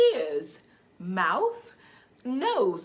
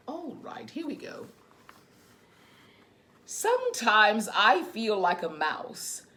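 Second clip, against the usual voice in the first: put-on reading to children; 3 semitones higher, slower than usual. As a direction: read gently to little ones. Reads picture book to children.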